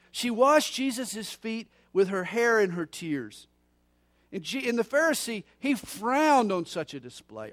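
loud, rising revival preaching; preaching at full force. Acted. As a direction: build it up like a pulpit climax.

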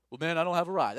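a friend griping he has no ride, loud; friend's excuse. Pretend. Invitee pleading no ride to church, loud complaining.

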